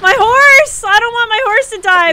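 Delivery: high, loud, playful lament; dismayed cry. Pretend. Player fearing the horse will die, high dismayed cry. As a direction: cry out for your poor horse.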